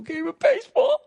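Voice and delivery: hammed-up weepy retirement speech; imitating tearful speech. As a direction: voice breaking high into mock tears. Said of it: "when guys would start crying".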